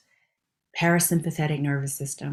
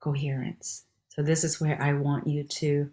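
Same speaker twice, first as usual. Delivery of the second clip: ordinary talk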